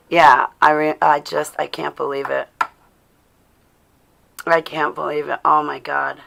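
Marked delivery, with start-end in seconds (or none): none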